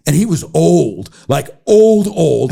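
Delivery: mock-dramatic old old old; emphatic old. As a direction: lean on old, old, old. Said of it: "And then he goes".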